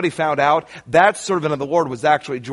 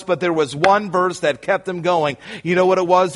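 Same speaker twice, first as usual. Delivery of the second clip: normal talking voice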